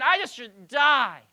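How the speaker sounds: hammed-up despair, loud and slow; a loud, slowed moan of wanting to die; angry complaint. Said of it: "him being so angry and saying".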